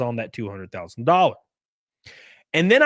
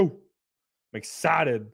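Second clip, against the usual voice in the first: ordinary talk, nothing marked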